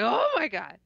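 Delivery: retold shock. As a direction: act out stunned recognition. Retold recognition, face in hands.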